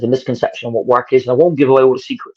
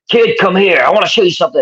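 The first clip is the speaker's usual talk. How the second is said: attempted American accent; 5 semitones higher than usual. To a young wrestler backstage, a rough American accent. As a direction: imitate Bob Holly in a shaky American accent. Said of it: "I can't do an American accent, but I'll try".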